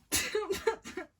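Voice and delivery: a loud embarrassed giggle; burst of giggling. Real, not acted. Flustered by a kissing scene.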